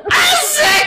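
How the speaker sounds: high gleeful shout; excited whoop. Real, not acted. Brother cheering once she finally says it.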